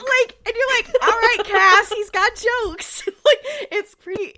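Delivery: high, loud, through laughter; laughing hard, voice shooting up; cracking up. Real, not acted. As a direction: crow it while laughing at the joke. Cracking up at the angel's joke.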